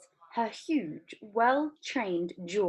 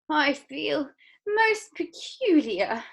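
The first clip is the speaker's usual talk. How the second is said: slow, breathless; gasped; 8 semitones higher, 5 dB louder, much slower than usual. Acted. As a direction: gasp it out. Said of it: "gasped Violet".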